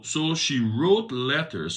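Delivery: slow the story down with weight; scripture reading. A narrator's reading. Preacher reading how Jezebel wrote letters, slow reading voice.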